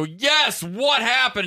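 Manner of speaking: high, incredulous mock outburst; retold exasperation. Host retelling his answer to the culprit, exasperated.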